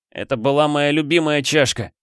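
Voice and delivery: high-pitched dismayed complaint; upset. A narrator's reading. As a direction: protest the loss with mild hurt. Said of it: "расстроился Тайло". (расстроенно, с обидой)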